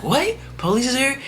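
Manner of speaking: high, shocked mother's voice; high startled mother; alarmed mama. Retold or imitated. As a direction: mom hearing bad news.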